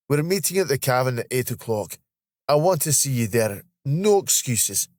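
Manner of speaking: playful Scottish insistence; insistent Scottish colleague. Acted. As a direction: press the invitation in Scots, slowing for the order. Voices Steve, Scottish colleague.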